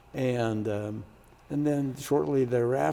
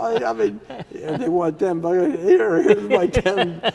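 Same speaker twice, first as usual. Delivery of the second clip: loud, much higher retold protest; flustered protest; 8 semitones higher, 9 dB louder than usual. New senator answers the Senate president's scolding, flustered protest. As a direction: act out a hurried, high-pitched backpedal.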